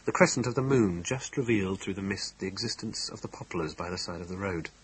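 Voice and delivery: usual speaking voice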